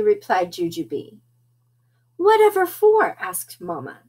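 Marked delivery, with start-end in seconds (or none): 2.2-3.2 baffled mother. Acted. Mother questions the girls.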